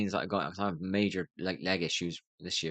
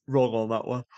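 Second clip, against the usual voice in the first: ordinary delivery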